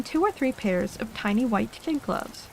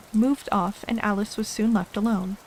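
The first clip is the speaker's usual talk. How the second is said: usual speaking voice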